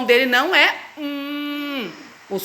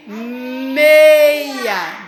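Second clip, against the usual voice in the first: meia drawn out long, louder, rising at the end; slow sounding out; 2 semitones lower, 4 dB louder than usual. Shown as a demonstration. Teacher modelling slowly for Luquinha.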